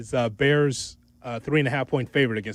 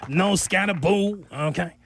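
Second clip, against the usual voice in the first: put-on New York accent; 5 semitones higher than usual. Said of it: "putting on the New York accent".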